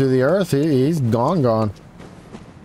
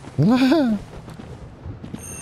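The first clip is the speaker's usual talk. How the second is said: high-pitched gleeful laugh; gleeful cackle at luck; delighted high laugh; 13 semitones higher, 3 dB quieter than usual. Real, not acted. Game helps him, he laughs.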